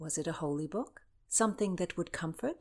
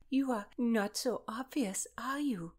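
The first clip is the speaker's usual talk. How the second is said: amused; 4 semitones higher than usual. A narrator's reading. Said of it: "a quizzical and then amused look followed".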